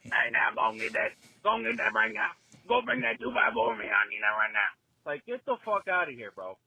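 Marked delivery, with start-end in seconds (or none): 0.0-4.7 a lightly voiced bossy old foreman; imitating old tradesmen. Young tradesman mocking older coworkers, loud and bossy. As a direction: bark orders like a burned-out old foreman. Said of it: "Talking like this".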